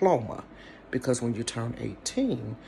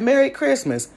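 high, loud holiday wish; cheery wish; 13 semitones higher, 8 dB louder than usual. Retold or imitated. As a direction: sing out the greeting. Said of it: "He said".

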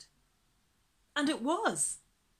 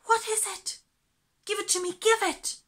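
high, loud, eager grabbing; a child desperate to see the parcel; trembling excitement; 12 semitones higher, 9 dB louder than usual. Acted. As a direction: beg for it, breathless with excitement. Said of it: "trembling with excitement".

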